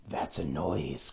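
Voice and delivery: low, slow, quiet relish; grinning Grinch. A narrator's reading. The Grinch grins at the coming cries, low and slow. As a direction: savor each word like a villain. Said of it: "grinned the Grinch".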